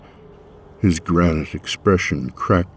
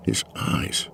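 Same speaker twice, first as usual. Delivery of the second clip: hushed, breathless awe; awed whisper; 7 dB quieter than usual. A narrator's reading. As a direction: breathe it in wonder. Awed hush.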